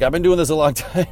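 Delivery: proud claim cracked by a laugh; laughing quote. Retold or imitated. Quoting the kid's proud claim.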